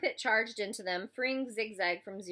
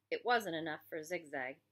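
ordinary delivery, nothing marked

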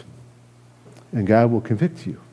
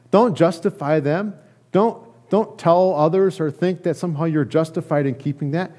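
ordinary talk

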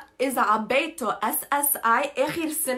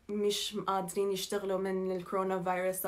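ordinary talk, nothing marked

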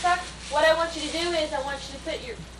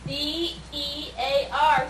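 letters spelled very slowly; spelling out; 3 semitones lower, much slower than usual. Shown as a demonstration. Parent spelling the word out slowly for a child.

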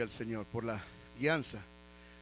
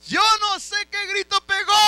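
very high, loud shout; preaching shout; 13 dB louder than usual. Real, not acted. Preacher repeating the remark about the king's cry, preaching shout. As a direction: belt it out to the whole church.

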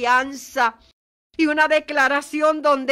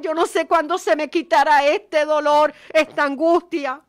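a grieving man's plea, rising higher and louder; retold weeping plea; 5 semitones higher, 4 dB louder than usual. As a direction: voice the mourner's despair with mild urgency. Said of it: "Y seguía llorando y llorando".

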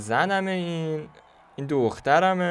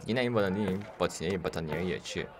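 ordinary talk, nothing marked

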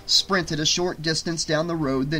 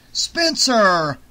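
loud, higher outburst at his partner; angry swear; 6 semitones higher, 7 dB louder than usual. A narrator's reading. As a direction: yell the name in a burst of anger. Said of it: "Andy swore".

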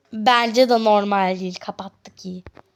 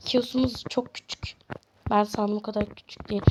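soft unvoiced aside; whisper; 3 semitones lower, 9 dB quieter than usual. Real, not acted. Streamer whispering a secret to viewers. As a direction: confide it in a hush.

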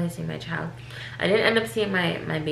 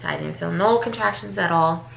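ordinary talk

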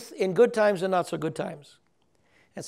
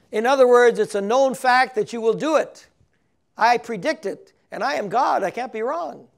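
louder, higher and insistent; emphatic; 4 semitones higher, 7 dB louder than usual. Pretend. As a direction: proclaim it as God, with no room for doubt. Preacher voicing God's certainty.

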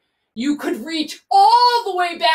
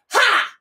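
breathy ha; 8 semitones higher, 3 dB louder than usual. Shown as a demonstration. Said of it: "Can you make that noise?".

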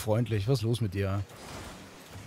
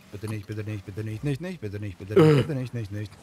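mock pleading; 6 semitones lower, 4 dB quieter than usual. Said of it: "mocking friend's plea".

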